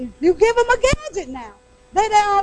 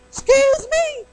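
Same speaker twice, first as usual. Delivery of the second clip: incredulous; 8 semitones higher, slower than usual. Real, not acted. Incredulous reaction.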